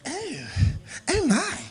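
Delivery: high, excited stuffy scientist; posh scientist voice. Acted. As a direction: squeal over a big discovery. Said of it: "they in there like they say".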